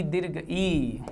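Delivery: slow, stretched दीर्घ ई, each sound held long; a teacher slowly stretching out दीर्घ ई; drawn-out teaching stress. Shown as a demonstration. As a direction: draw out दीर्घ ई slowly so students hear the long ई.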